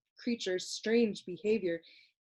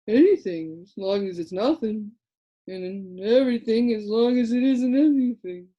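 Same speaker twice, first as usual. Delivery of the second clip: sleepy Lithargian; 7 dB louder than usual. A narrator's reading. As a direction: drone it lazily like a half-asleep creature. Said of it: "explained another".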